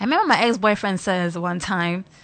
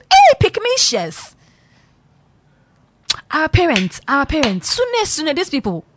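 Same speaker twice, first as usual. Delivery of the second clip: shrill mock cry; 7 semitones higher, 6 dB louder than usual. Real, not acted. Vlogger crying out at pick-me wives, loud and very high.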